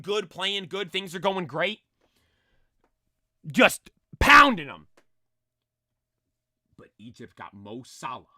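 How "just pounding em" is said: excited. Real, not acted. Excited about team dominating.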